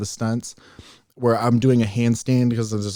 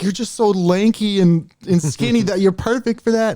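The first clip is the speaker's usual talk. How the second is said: mocking admirers; 9 semitones higher, 3 dB louder than usual. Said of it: "And everybody was like".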